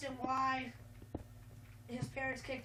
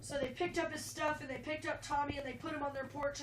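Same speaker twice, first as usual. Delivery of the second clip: normal talking voice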